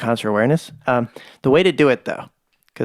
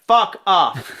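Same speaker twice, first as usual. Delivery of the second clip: mock snap; 5 semitones higher, 3 dB louder than usual. Snapping back at doctor's joke.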